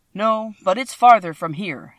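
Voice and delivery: usual speaking voice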